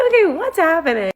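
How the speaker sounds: high confused question; baffled. Retold or imitated. Stranger grabs her phone, high baffled question. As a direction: pitch it up, totally thrown.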